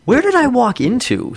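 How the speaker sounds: high-pitched mock disbelief; incredulous. Telling co-host of the bar's hipsters, incredulous. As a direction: act out baffled disbelief. Incredulous reaction acted in retold story.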